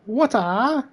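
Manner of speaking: high, drawn-out one-word cry with a rising end; a quick, high martial-arts-star take on the word Water; Bruce Lee impression. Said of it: "Or as Bruce Lee says".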